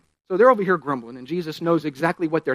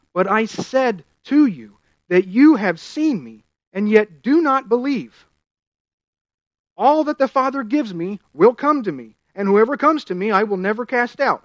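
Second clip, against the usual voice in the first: preaching scripture; 3 semitones higher, 3 dB louder than usual. Acted. Preacher reading Jesus' words aloud to the church, proclaiming. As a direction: proclaim the verses with full force.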